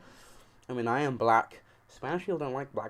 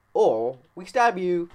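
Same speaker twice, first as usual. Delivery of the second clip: louder, higher, slow mock threat; 6 semitones higher, 8 dB louder, slower than usual. Gang members threatening the kids, loud slow mock threat. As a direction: menace them, slow and loud. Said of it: "they were like".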